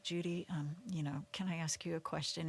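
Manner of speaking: soft, hushed pastoral ask; gentle chaplain. Retold or imitated. Chaplain softly asking the dying mother a question. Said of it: "the chaplain comes in and he's like".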